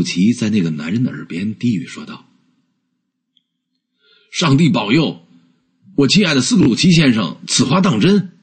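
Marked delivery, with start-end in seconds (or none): 4.3-8.3 raised pitch, astonished but not shouted; mild exclamation of happy disbelief; delighted surprise. A narrator's reading. The gentleman answers Scrooge's whisper, delighted surprise. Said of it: "十分惊喜地大喊道".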